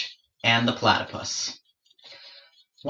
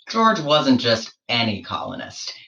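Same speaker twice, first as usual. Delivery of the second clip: plain reading voice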